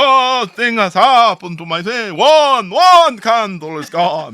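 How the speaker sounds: loud, high Jamaican lament; Jamaican man. Acted. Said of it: "this large Jamaican man".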